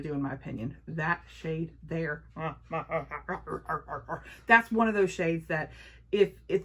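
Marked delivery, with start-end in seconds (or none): 2.3-4.4 laugh. Real, not acted. Delighted laugh at a shade.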